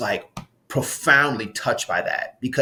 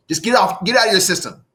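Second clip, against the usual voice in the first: playful urging; 6 semitones higher, 3 dB louder than usual. Shown as a demonstration. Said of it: "point in the air and say".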